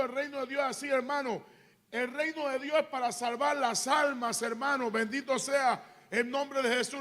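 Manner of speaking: loud, driving sermon delivery; full-force preaching. Real, not acted. Preacher urges brethren to plant churches and save souls, loud and impassioned. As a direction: press the point hard on the congregation.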